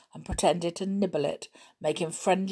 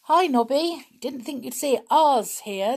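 high, loud call; hailing; 8 semitones higher, 7 dB louder than usual. Acted. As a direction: call out across the camp. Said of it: "Julian hailed him".

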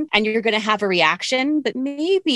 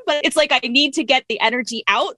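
usual speaking voice

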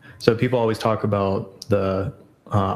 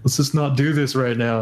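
weary complaint; 3 semitones higher than usual. Retold or imitated. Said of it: "and I'm like".

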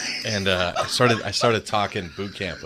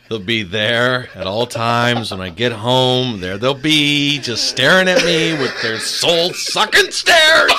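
slow build from raised voice to high shout; comic rant; 4 semitones higher, 7 dB louder, slower than usual. Acted. Home-all-summer kids described to co-host, mounting comic exasperation. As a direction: stretch each word, climbing louder into a mock-horrified yell.

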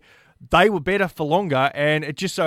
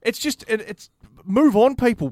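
exasperated rant; 7 semitones higher than usual. Real, not acted. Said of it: "you had a rant about it".

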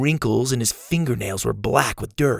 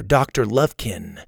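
ordinary delivery

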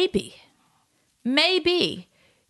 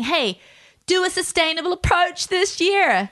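loud and passionate; 8 semitones higher than usual. Pretend. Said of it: "we're gonna be loud and passionate saying".

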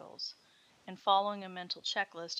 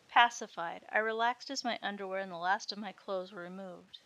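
ordinary delivery

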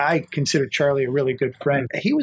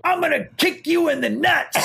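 imitating angry threat; 10 semitones higher than usual. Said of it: "And he goes".

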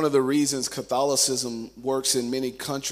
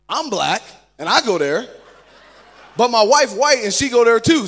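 loud, punched-up cheeky comeback; playful mock defensiveness; comic retort; 6 semitones higher, 10 dB louder than usual. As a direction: deliver it as a punchline, rising higher and louder. Comic retelling of cheeky answer.

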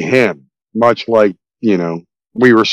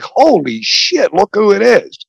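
high, drawn-out astonishment; stunned recognition; 8 semitones higher, slower than usual. Retold or imitated. Moviegoer reliving spotting the actor, stunned. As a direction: relive the shock, slow and high-pitched. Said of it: "I audibly gasped".

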